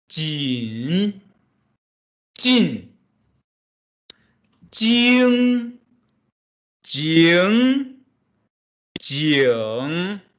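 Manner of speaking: jin and jing through the tones; teacher demonstrating tones; Mandarin tones drill. Shown as a demonstration. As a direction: model the tones for the class. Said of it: "Read, please".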